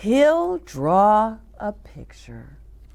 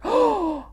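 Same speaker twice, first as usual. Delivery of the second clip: mock gasp; 14 semitones higher than usual. Reacting to mouse in mirror.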